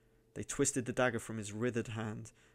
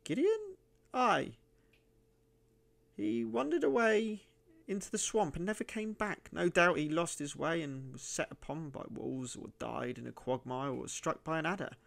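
put-on suspicious old miser in a high, cracked voice; high, croaking old miser; 7 semitones higher, 3 dB louder than usual. A narrator's reading. Narrator voicing the miser answering the stranger, high and wary. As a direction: pitch it way up like a wary old hermit.

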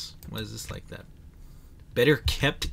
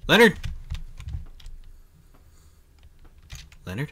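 calling a lost pet; 4 semitones higher, much faster than usual. Real, not acted. Calling his lost dog.

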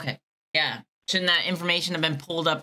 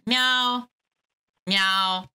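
cat meows; 3 semitones higher than usual. Pretend. Caller meowing at the agent. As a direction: meow like a cat. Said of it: "it's like that".

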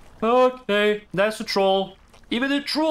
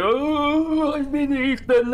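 mock lament; 7 semitones higher, slower than usual.

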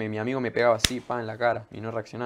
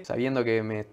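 ordinary talk

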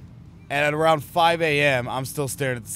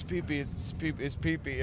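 soft, dazed repetition; imitated sleepy mumbling; 9 dB quieter than usual. Imitating sleepwalking roommate who ignores the warning, soft mumble. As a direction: murmur it like a drunk sleepwalker.

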